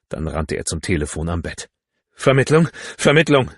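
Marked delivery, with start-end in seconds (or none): 2.1-3.5 loud, high shout into the phone; raised, pressing phone call; urgent call. A narrator's reading. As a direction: call frantically for the operator. Urgent call to the telephone operator.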